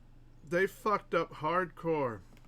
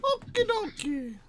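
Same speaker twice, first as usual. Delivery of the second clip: very high, slow cartoon voice; squeaky cartoon okie dokie; slower than usual. Higher cartoon voice again.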